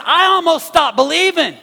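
"I almost stopped believing" cried out loud and high; loud preached cry. Retold or imitated. Pastor crying out David's words to the congregation, loud and high.

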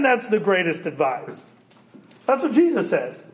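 usual speaking voice

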